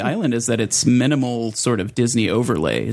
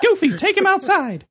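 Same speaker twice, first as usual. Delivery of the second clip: very high squeaky cartoon mouse; squeaky mouse giving an order; Mickey Mouse voice.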